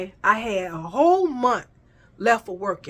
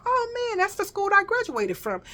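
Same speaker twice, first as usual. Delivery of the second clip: much higher, amazed reaction; retold excitement; 10 semitones higher than usual. Job seeker learns it is their old school, excited. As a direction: replay your delighted surprise, pitched way up.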